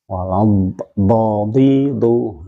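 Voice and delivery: "do, di, du" thick and drawn out. Shown as a demonstration. Teacher drilling isti'la with three vowels, thick and slow. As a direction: lift the tongue root through "do, di, du". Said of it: "Pangkal lidah dinaikkan ke atas".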